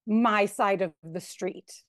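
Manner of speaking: normal talking voice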